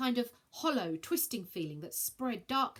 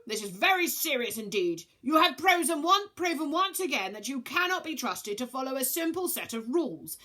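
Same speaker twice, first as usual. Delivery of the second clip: stern rebuke; 7 semitones higher, 8 dB louder than usual. Acted.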